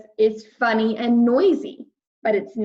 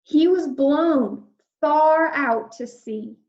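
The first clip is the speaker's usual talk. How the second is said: slow, rising storyteller voice for the storm; dramatic storm narration; 5 semitones higher, slower than usual. A narrator's reading. Reading the storm scene to children, slow and dramatic. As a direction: build the drama as the storm hits.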